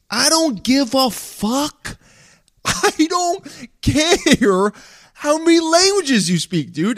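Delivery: high, drawn-out exasperated whine; hammed-up, laughing exasperation; exasperated mock outburst. Mock outburst at imagined show-off.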